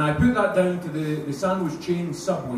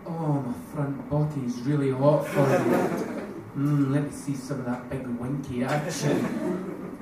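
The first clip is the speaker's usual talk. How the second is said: lower, slow sultry mock seduction; mock porn voice; 4 semitones lower, slower than usual. Comic imagining a porn film, low slow hammed-up seduction. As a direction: purr it like a cheesy porn actor. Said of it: "imagine a porn film now".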